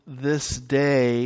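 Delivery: "this day" drawn out very slowly; stress on "this day". Retold or imitated. Preacher quoting Jesus to his church, stress on "this day". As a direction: lean long and hard on "this day". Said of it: "make an emphasis on this day".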